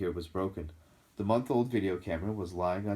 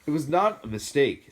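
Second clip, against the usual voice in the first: louder, hot protest; angry; 7 semitones higher, 8 dB louder than usual. A narrator's reading. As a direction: answer back angrily. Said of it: "said Harry angrily".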